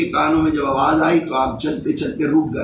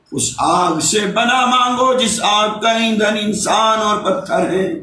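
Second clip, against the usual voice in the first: raised, ringing sermon voice into Arabic verse; impassioned preaching; 4 semitones higher than usual. Acted. Boy reciting today's lesson about the Fire, declaimed by preacher.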